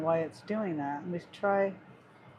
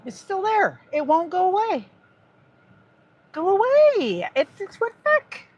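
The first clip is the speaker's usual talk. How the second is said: higher and louder, fed up with the phone; exasperated outburst; 11 semitones higher, 7 dB louder than usual. Real, not acted. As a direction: yelp at the screen that won't obey. Phone keeps popping back up.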